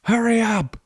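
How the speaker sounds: high mock yell of someone knocking; hammed-up impatient voice outside the porta potty; impatient stranger. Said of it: "Banging on the, on the".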